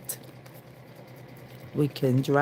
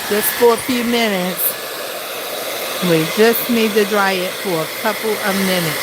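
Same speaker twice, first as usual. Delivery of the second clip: over hair dryer; 4 semitones higher, 4 dB louder than usual. Real, not acted. Talking over running blow dryer.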